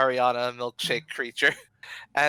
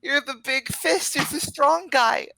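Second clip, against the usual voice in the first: put-on very high little-kid voice; pleading child; 11 semitones higher than usual. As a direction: plead in a squeaky child's pitch. Kids plead with their hero.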